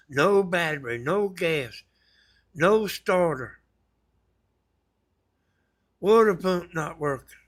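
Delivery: fed-up tally of a junk boat; exasperated list. Real, not acted. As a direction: rattle off everything that was wrong. Listing the boat's failings.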